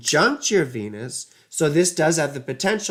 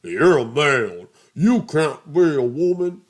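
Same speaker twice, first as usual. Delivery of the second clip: mocking critic; 5 dB louder than usual. Mocking people who call her voice male, sneering. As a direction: sneer like a heckler. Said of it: "Some assholes can be like".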